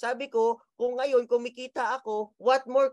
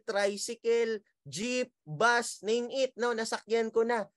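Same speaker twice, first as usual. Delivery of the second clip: usual speaking voice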